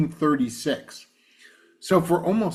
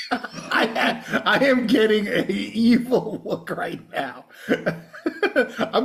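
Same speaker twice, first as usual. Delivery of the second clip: laughing; 5 semitones higher, much slower than usual. Real, not acted. Laughing at a rude joke.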